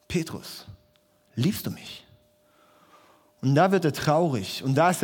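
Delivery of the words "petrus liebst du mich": hushed, half-whispered question; Jesus asking Peter again, hushed; soft Jesus question. Retold or imitated. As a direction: ask gently, almost under your breath. Jesus asks the third time.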